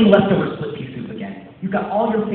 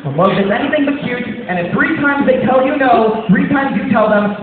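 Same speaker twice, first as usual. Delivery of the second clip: loud climax; 8 semitones higher, 6 dB louder than usual. Acted. Climax of hype speech.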